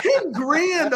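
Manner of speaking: mock shock at the price; laughing incredulous echo; retold disbelief. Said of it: "I was like".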